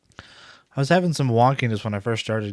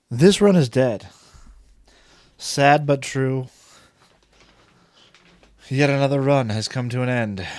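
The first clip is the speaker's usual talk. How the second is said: hammed-up solemn announcement; mock eulogy; 3 semitones higher, 5 dB louder than usual. Streamer mourning his failed run as a mock funeral speech. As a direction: announce the death like a funeral host.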